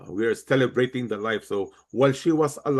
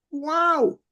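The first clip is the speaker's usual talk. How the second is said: astonished wow. Pretend. Imagining the widows' astonishment.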